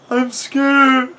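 high, loud frightened cry; hammed-up scared squeal; scared Riley. A narrator's reading. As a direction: wail it in mock terror. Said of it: "Riley said".

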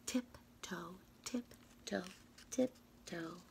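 soft whispered tiptoe chant. Acted. Reader tiptoeing through the library, whispered chant. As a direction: whisper each tiptoe like sneaking.

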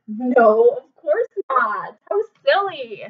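plain reading voice

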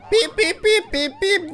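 high imitated electronic pip pip; squeaky high beeps; beeping noise. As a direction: mimic a gadget beeping. Imitating gadget beeping in game.

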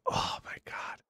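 whispered dread. Retold or imitated. Dread at being called out.

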